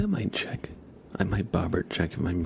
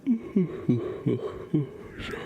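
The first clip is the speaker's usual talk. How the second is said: yawning laugh; 7 semitones higher than usual. Real, not acted. Laughs into a yawn.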